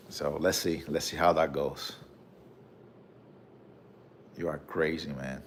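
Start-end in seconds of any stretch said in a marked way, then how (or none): none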